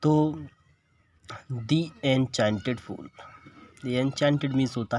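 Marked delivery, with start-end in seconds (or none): 1.7-1.8 The with a long ee vowel; THE pronounced "dee". Shown as a demonstration. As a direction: say The as "dee" before Enchanted. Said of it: "दी पढ़ेंगे".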